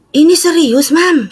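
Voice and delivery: high earnest insistence; assuring mother, high and insistent. Retold or imitated. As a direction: push it up high. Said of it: "saya bilang".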